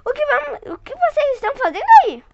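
high, fast startled question; astonished. Acted. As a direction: blurt it out amazed.

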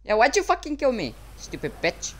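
ordinary delivery

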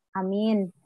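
ordinary talk, nothing marked

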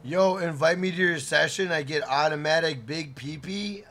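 ordinary delivery

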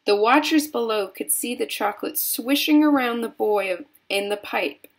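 ordinary delivery

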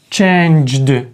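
"Changed" drawn out to a voiced final d; ending D. Shown as a demonstration. Teacher modelling the rule slowly, "Changed" with voiced d. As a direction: stretch "Changed" and release the d. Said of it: "у нас тут буде «д»".

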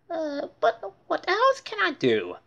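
high, lightly voiced plea; helpless Buster. Buster asking his friends for help. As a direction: ask helplessly.

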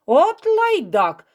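loud, high, stretched-out curse; angry insult. A narrator's reading. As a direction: spit out the insult, drawn out and loud. Furious man swings his fist.

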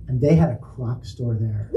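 normal talking voice

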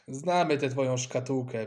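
ordinary delivery, nothing marked

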